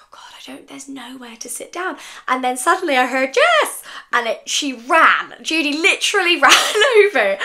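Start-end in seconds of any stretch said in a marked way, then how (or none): 0.0-2.0 hushed and despairing, breathed out to herself; panicked whisper. Retold or imitated. Retelling how she whispered to herself, finding nowhere to sit. 3.3-3.8 high, loud call across a crowded room. Retold or imitated. Judy spotting her friend across the busy hall and calling out.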